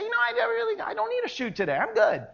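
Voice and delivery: too-relaxed, nonchalant refusal; breezy dismissal. Acted. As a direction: wave it off airily, tailing into a soft aside. Mimics a casual skydiver declining.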